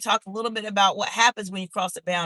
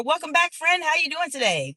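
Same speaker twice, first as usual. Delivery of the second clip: excited greeting; 8 semitones higher than usual. Real, not acted. Greeting returning viewer.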